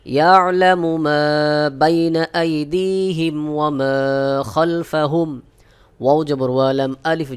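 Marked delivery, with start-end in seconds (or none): 0.0-5.3 slow chanted recitation; teacher models the verse in slow tajweed; Quranic recitation. Shown as a demonstration. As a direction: recite it slowly and melodiously.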